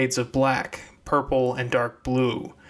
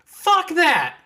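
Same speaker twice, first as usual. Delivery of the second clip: loud, high defiant shout; 5 dB louder than usual. Acted. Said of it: "Clementine shouted".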